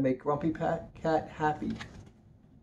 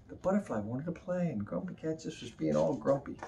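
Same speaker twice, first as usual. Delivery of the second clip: usual speaking voice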